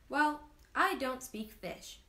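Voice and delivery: put-on boy snarks back at the know-it-all tree; snarky reply. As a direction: lean on the sass, drag it out. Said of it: "the boy snarked".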